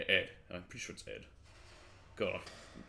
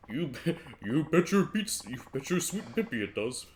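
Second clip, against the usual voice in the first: failed Ed impression; 4 semitones higher, 3 dB louder than usual. Drinker answers whether sake is allowed here, failed impression. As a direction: stumble through a silly character voice. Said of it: "I can't do Ed's voice".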